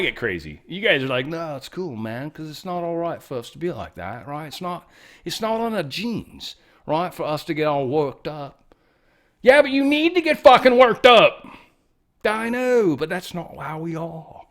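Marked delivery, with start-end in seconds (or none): 1.2-8.7 put-on half-hearted British accent shrugging it off; mock British. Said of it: "get better at speaking a British accent". 9.4-11.5 angry shout. Real, not acted. Ranting back at English viewers. 12.2-14.4 a hint of a British accent, trailing off; mock British. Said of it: "speaking a British accent".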